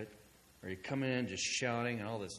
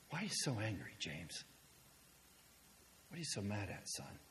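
gentle questioning; 2 semitones lower, 8 dB quieter than usual. Acted. Gently probing the angry neighbor afterwards.

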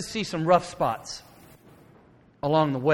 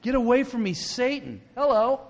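high, incredulous echo; shocked disbelief; 10 semitones higher, 4 dB louder than usual. Acted. Preacher repeating the Savior's harsh words, shocked disbelief. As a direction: throw the harsh words back in astonishment.

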